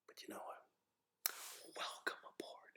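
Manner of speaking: slow, suspenseful whisper; whispered reveal. Acted. Interviewer quietly telling the applicant he is hired, whispered. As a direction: whisper it like a secret surprise.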